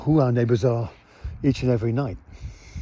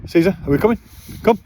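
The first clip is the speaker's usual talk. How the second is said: higher, louder call to the dog; 6 semitones higher, 4 dB louder, much faster than usual. Real, not acted. Owner calling the dog to come along, raised voice. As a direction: sing out to the dog to follow.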